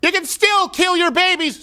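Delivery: high, indignant shout; outraged shout. Real, not acted. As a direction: cry it out in outrage. Outraged rant continues, shouted.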